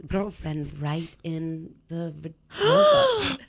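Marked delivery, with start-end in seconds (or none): 2.5-3.4 squeaky vulva. Retold or imitated. Punchline reveal, high squeak.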